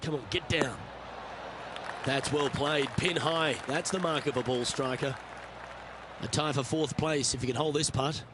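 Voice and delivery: calm, measured broadcast commentary; golf commentator. Acted. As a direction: call the shots like a golf broadcaster. Game commentator calls the shots.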